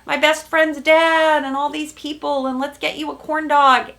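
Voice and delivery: high, loud, eager gushing; imitating an excited boyfriend going full on; eager rush. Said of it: "He's going full on".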